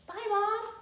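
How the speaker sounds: put-on high-pitched little kid farewell; quick sing-out, high kid voice; child's goodbye. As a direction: call it back lightly in a child's high voice. Acting the child's look-back goodbye.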